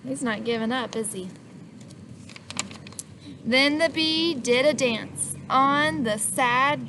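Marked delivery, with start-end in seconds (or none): none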